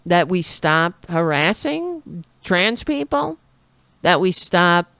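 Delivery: ordinary delivery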